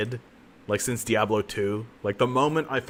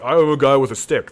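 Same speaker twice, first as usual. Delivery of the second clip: mock dumb; 4 semitones higher, 9 dB louder than usual. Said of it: "My Templar was just like".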